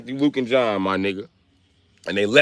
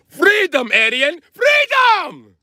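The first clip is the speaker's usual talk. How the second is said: much higher, drawn-out shouted freedom; mock battle cry; 4 dB louder, much slower than usual. Comic ending the Russian bit with a mock battle cry. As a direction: yell it like a cartoon war cry.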